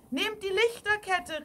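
high, loud urgent instruction; girl calling out. A narrator's reading. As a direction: call out the rescue idea. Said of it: "rief das junge Mädchen".

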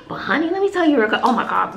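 ordinary talk, nothing marked

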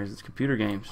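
usual speaking voice

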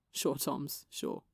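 soft, fading sarcastic humouring; humouring disbelief. Acted. Wilbur humouring Tommy's protest, softly fading disbelief. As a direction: humour him, not believing a word.

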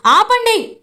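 sharp high shout to halt everyone; shouting stop. Acted. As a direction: yell it to stop the ritual. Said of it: "గట్టిగా అరుస్తాడు గగన్".

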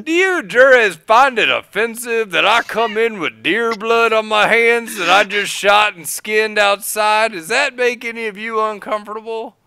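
high, loud booming address to the jury; loud courtroom voice. Retold or imitated. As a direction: project it to the whole courtroom. Lawyer loudly addressing rural jury.

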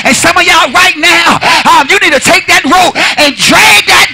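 high, loud shouting exhortation; whooping preacher. Acted. Pastor urging the church to praise, loud whoop. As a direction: shout it up to close.

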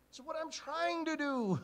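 loud high protest; shouting back. Acted. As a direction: yell back over the crowd. Blind man shouts back.

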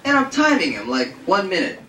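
plain reading voice

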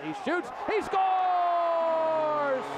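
long, stretched, loud goal shout; excited goal call. Real, not acted. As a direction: belt out the goal slowly and high.

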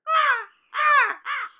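high loud cawing ah ah ah; loud cawing crow squawk. A narrator's reading. Crow cawing mid-speech.

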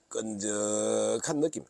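"끈적한 느낌" drawn out slow and soft; "끈적한 느낌" stretched out and quiet; slow emphasis. Shown as a demonstration. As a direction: savour "끈적한 느낌" word by word. Repeats phrase slowly, savouring it.

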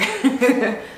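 quick amused chuckle; short laugh. Real, not acted. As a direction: laugh at your own joke. Laughs at own joke.

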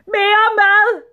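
very high, loud, drawn-out scream; screamed demand. A narrator's reading. Greedy sultan demanding food from his servants, screaming. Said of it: "skreg sultanen".

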